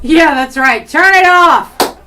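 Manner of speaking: loud, high, slow yell; shouting. Pretend. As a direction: bellow at the losers to leave. Yelling at lake partiers.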